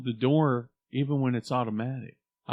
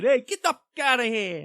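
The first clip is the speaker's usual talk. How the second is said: mock rude shout; 13 semitones higher, 6 dB louder than usual. Acting a rude greeting.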